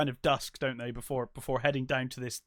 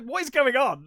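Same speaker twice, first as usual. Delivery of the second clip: exasperated question; 9 semitones higher, 7 dB louder than usual. Retold or imitated. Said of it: "I'm like".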